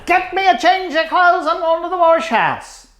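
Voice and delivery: high, loud quoted grandfather; calling out for clean clothes; gruff grandfather. Retold or imitated. Grandfather home from the track calling for clean clothes, high and loud.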